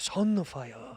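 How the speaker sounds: caricatured Japanese "Sunfire"; mock Japanese accent. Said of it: "they don't say it like that".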